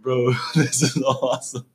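half-laughing mock bro; slow laughing bro voice. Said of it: "I was like".

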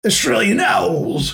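mock Australian accent, slow and drawn out. Host trying an Australian accent on his co-host, slow and mock. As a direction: stretch the vowels in a hammed-up Australian accent. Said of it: "Have I got that accent right".

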